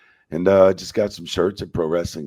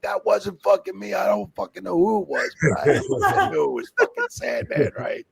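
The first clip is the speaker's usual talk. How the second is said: protesting innocence; 13 semitones higher than usual. Retold or imitated. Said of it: "I'm like".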